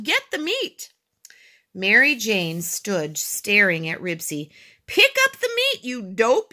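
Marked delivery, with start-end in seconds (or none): 0.0-0.9 high, urgent cry; high-pitched urgent order; shouting. A narrator's reading. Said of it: "with almost all the breath". 4.9-6.5 high, loud impatient yell; exasperated high-pitched shout. A narrator's reading. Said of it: "yelled Robert".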